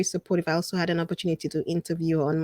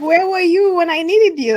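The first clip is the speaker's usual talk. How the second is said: high, loud mock plea; retold exclamation; 11 semitones higher, 8 dB louder than usual. Her answering the admiring coach, loud high mock plea. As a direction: call it out high, half laughing.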